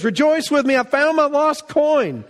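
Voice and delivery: woman celebrating her found coin; joyful woman. Retold or imitated. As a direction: call the neighbours over in delight.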